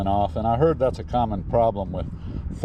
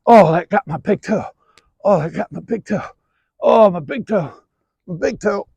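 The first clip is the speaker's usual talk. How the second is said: groaning in pain; 10 semitones higher, 6 dB louder than usual. Real, not acted. Groaning after hurting toe.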